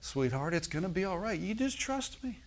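soft, high, tender reassurance; gentle coaxing, rising in pitch; reassuring God. Acted. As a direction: soothe like a parent to a sweetheart, climbing high. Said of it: "He wants to let you know".

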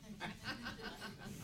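a short high laugh breaking out; surprised laugh; real burst of laughter. Real, not acted. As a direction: laugh out in disbelief. Laughs after God's odd request.

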